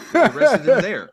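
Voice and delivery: high, loud burst of laughter through the words; laughing hard at the joke. Real, not acted. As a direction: crack up while saying it.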